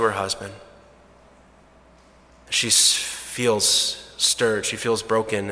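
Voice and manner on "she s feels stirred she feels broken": hushed sorrow. A narrator's reading. Sad turn in the story.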